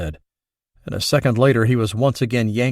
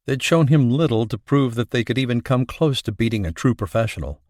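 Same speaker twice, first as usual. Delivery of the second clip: ordinary delivery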